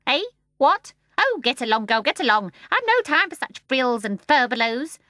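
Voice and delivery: loud, high, impatient brush-off; dismissive starling. Acted. The starling brushes off Mary Poppins, loudly dismissive. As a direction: wave her away, loud and impatient.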